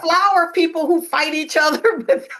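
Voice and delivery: amused, laughing through the words. Real, not acted. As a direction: giggle through the line as the joke lands. Joking about flower fights.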